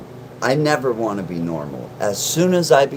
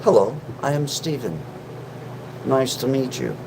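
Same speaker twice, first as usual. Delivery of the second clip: a lightly put-on prim Steven voice; Steven persona; 4 dB quieter than usual. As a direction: introduce yourself as a polite alter ego. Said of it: "you guys have just met Steven".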